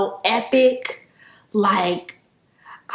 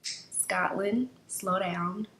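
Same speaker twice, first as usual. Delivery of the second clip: gentle advice; 2 semitones lower, 10 dB quieter, slower than usual. Real, not acted. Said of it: "To my younger self".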